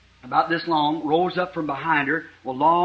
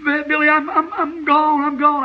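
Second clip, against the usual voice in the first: put-on high, voice breaking like a weeping girl; retold crying, voiced high; imitating crying; 9 semitones higher than usual. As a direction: act out a sobbing girl in despair. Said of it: "she's just crying like that".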